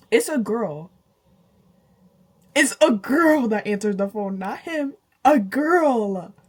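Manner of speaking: put-on it's a girl, building shocked repeats; shocked disbelief. Shocked retelling of discovery.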